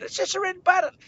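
put-on high, loud kid protest. Kid brushes off his friend's warning, high protest. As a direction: argue back high and loud.

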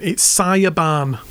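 slow Hull caller saying Siobhan; drawn-out Hull accent; chavvy Hull accent. Retold or imitated. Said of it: "really chavvy Hull accent".

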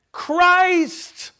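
CHRIST cried out high and loud, held long; big, stretched stress on CHRIST; emphatic highlighting. Shown as a demonstration. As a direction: proclaim CHRIST as a ringing exclamation. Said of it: "read it in that order".

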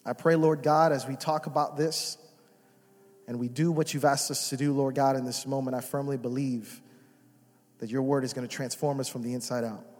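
hushed, reverent prayer voice. Acted. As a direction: pray it low and earnest over the room. Leads a prayer.

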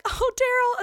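very high, loud put-on greeting; pretend conversation. Covering for an absent coworker, pretend conversation. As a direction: call it out high, faking a chat. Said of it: "she pretends to have a conversation with him".

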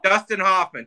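lightly voiced Dustin Hoffman; Dustin Hoffman impression. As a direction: ease into a Hoffman voice. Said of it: "I'll do".

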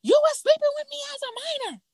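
very high-pitched mock yell; high, wailing imitation of a yell; imitated yelling. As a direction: act out her screamed accusation. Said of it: "She's yelling".

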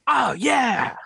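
high, mock-ecstatic shout; jamming rocker. Imitating a man jamming away, high exultant yell. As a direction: cry out like a musician lost in a jam. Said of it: "jamming away".

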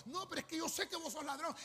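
accusing. Acted. Acted quarrel with thief.

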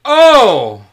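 loud "oh" at a burn; surprised shout. Real, not acted. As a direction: react loudly to the insult. Reacting to a jab.

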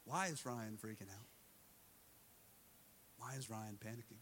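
soft, low imitation of a counselor praying; hushed questioning. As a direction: ask it quietly, as if in prayer. Counselor solemnly addresses Holy Spirit.

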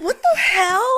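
high, loud indignant outburst; mad. Retold or imitated. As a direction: shriek it in retold outrage. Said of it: "I was so mad".

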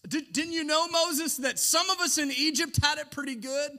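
high, loud whining rebel; Korah complaining. Acted. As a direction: complain to Moses as a spoiled rebel. Preacher voices Korah complaining to Moses.

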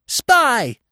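very high bird shriek. A narrator's reading. Jay accusing the dog from above, high shriek. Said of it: "shrieked the jay".

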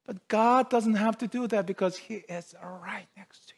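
hushed, breath-only preaching; whisper. Acted. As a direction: drop suddenly into an intimate whisper. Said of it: "God whispered with his truth and love".

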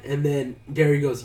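usual speaking voice

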